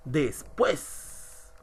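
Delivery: Después split up, "pués" emphasised; stress on final syllable. Shown as a demonstration. Teacher giving an example ending in S, Después stressed on "pués". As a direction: land firmly on the "pués" of Después. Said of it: "la mayor fuerza de voz en la última sílaba".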